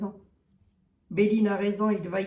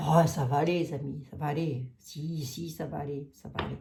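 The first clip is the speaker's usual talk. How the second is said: soothing reassurance; 4 semitones lower than usual. Acted. Comforting the gloomy French public.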